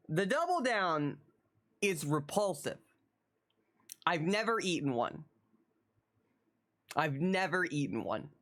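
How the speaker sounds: ordinary delivery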